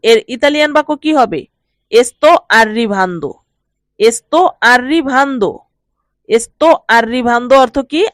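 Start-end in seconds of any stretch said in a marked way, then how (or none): 1.9-7.5 very slow, careful Italian; deliberate teacher's dictation pace; slow model phrase. Shown as a demonstration. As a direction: spell each syllable out for learners. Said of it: "teacher models Italian phrase slowly".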